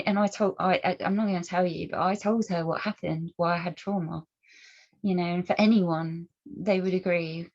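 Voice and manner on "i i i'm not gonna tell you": ordinary talk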